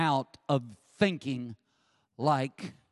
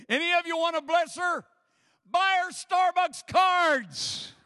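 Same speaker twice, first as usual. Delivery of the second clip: much higher, louder, slow and drawn out; excited mock appeal; 12 semitones higher, 4 dB louder than usual. Real, not acted. Urging the congregation to bless his wife, playful appeal. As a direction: ham up the plea.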